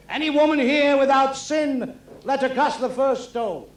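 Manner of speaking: slow, high and loud sermon voice; solemn preaching. Retold or imitated. Jesus addressing the crowd, slow and solemn. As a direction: pronounce it like a holy prophet. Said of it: "he said".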